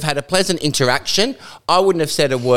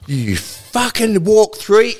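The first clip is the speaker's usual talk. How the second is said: retold fury; 8 semitones higher, much slower than usual. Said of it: "and I thought".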